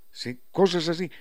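ordinary delivery

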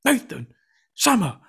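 high, slow, drawn-out call of names; stern teacher summons. Retold or imitated. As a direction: summon the boys like a head of year.

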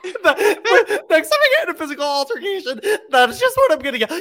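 fast giggly speech; laughing while talking. Real, not acted. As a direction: talk fast through laughter.

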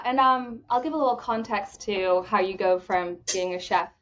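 ordinary delivery

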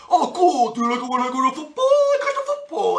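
very high, silly dim voice; hammed-up dumb jock voice; dopey dog-like jock. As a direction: act like a goofy dog of a jock to charm the girl.

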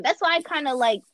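usual speaking voice

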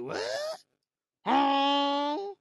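high-pitched, drawn-out "what? huh?"; mock-shocked high yelp; shocked reaction. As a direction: squeal it in exaggerated disbelief. Retells shocked reaction to strange voice.

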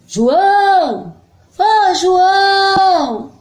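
mãe chamando de longe, cantado; calling out. Acted. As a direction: chama o filho em voz alta e arrastada. Mãe doente chama o filho. (chamado cantado de mãe)

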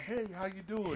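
high, casual greeting. Shown as a demonstration. Co-host imitating the host's everyday hello, high voice. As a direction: pitch the hello right up. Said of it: "you go high".

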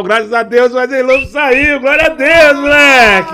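excited. Real, not acted. Friend out of hospital, celebrating.

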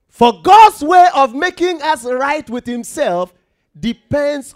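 high, loud preaching read; loud scripture proclamation. Acted. Preacher to congregation, loud proclamation. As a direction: declare it to the church.

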